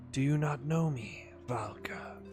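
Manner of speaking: slow whispering serpent voice. Acted. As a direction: breathe it out like an ancient serpent. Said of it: "You like my serpent voice?".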